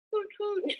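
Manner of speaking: high imitation owl hoot. Parent shows child what the owl says, high owl hoots. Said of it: "The owl says".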